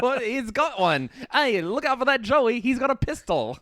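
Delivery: hammed-up panic at an armed kangaroo; hammed-up Aussie panic; mock alarm. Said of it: "You're like".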